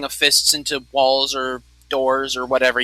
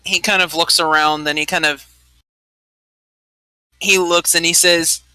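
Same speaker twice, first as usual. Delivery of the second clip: ordinary talk, nothing marked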